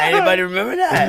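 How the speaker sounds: high laughing question. Real, not acted.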